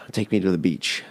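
a soft sigh then a quiet, weary plea. Retold or imitated. To his friend, longing for the beach: soft sighing plea. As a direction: sigh it out like you're sick of the desert. Pleading to friend about desert.

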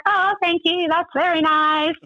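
high-pitched, slowing polite thanks; retold polite thanks. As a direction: act out politely humouring someone. Retold polite reply to driver.

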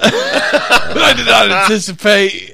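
slow, high, loud through laughter; cracking up while finishing his sentence; laughing. Real, not acted. Laughing finish of friend's line.

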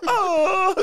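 high howl; wolf howl. Retold or imitated. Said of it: "just mixing it together".

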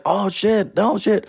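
high mock panic; kid panicking. Young [name] panicking as the car leaves, high mock voice. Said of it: "I'm like".

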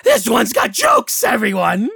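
high, loud jeering hag; mocking hag. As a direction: crow it at the whole crowd.

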